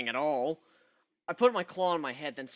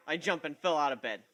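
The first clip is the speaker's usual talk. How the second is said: usual speaking voice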